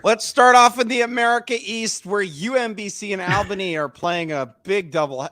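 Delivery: high, projected sports-show intro; hammed-up announcer. Podcast host kicks off the America East rundown, hammed-up announcer. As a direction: launch the matchup like a TV announcer.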